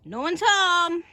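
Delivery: high, loud call; calling into the dark. Pretend. Called loudly.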